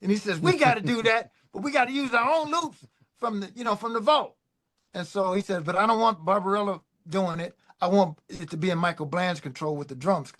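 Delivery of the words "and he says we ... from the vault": an excited boss with a new idea. Retold or imitated. Prince excited about loops.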